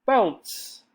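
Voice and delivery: ordinary delivery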